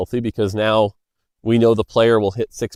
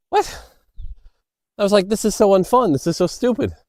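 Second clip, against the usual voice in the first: high-pitched exasperated disbelief, retold; retold indignation; 9 semitones higher than usual. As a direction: replay the baffled complaint, pitched up. Retells outrage after game death.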